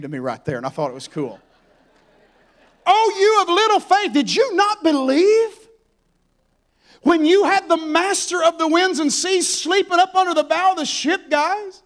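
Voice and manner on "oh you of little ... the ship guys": loud, high-pitched scolding; rebuke. Acted. Jesus to the disciples after the storm, loudly rebuking. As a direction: rebuke them at full voice, pitch way up. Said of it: "he rebukes them".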